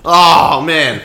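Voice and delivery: loud, high, exasperated outburst; exasperated, pitched well up and loud; real exasperation. Real, not acted. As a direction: groan it out loud at a terrible cliché. Exasperated at a clichéd film line.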